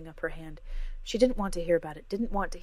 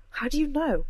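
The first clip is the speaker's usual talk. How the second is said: sharp, loud, higher snap; snapped; 9 semitones higher, 7 dB louder than usual. A narrator's reading. Cora snaps at Jem, loud and sharp. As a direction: bite back at her friend. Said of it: "Cora snapped".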